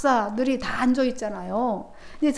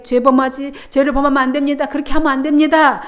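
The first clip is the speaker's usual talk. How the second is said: loud, raised warning to the people; loud earnest admonition; stern warning; 3 semitones higher, 6 dB louder than usual. Acted. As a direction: call out the warning like a prophet. Preacher voicing priests' warning.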